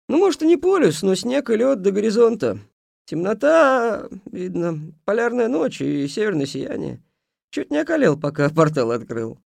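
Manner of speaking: put-on an old man spinning a tall tale. A narrator's reading. Old man voiced in story.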